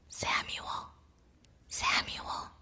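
soft breathy call from afar; a distant whispered call; gentle whisper. A narrator's reading. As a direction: call his name gently, barely voiced. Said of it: "the Lord called again".

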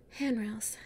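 ordinary talk, nothing marked

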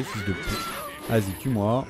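ordinary talk, nothing marked

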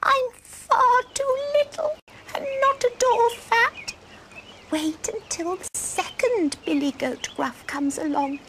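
high, pleading little-goat squeak; tiny goat voice. Acted. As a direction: talk your way out in a teeny voice. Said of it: "in a tiny voice".

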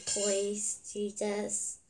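usual speaking voice

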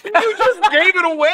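high laughing protest. Real, not acted. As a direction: laugh through the words. Laughing at spoiler.

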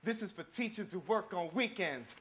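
quiet, measured slam poetry recital; spoken-word poem. Acted. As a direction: hold each line, let the pauses land. Spoken-word poem over music.

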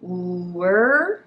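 drawn-out start of work; sounds blended slowly. Shown as a demonstration. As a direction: work stretched as oo-er. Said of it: "workを三つの発音に".

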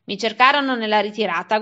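ordinary delivery, nothing marked

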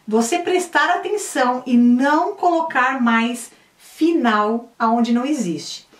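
ordinary talk

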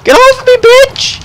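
high-pitched yelling under attack; panicked shout. Real, not acted. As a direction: scream to fend off an attacker. Attacked by enemies in the game.

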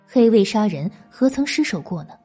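usual speaking voice

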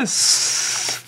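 breathy whisper of Christmas. Acted. Reader whispering the big reveal to children. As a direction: breathe the word out in wonder. Whispered final word of the story.

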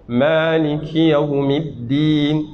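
slow tajwid recitation. Shown as a demonstration. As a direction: chant Maliki Yawmiddin slowly. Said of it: "Le Maliki, le Kaf".

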